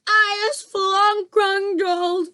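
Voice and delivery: very high, loud, slow scream; screaming giant. A narrator's reading. Trapped giant screams in fury. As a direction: scream like a tormented ogre. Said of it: "screamed the maid master".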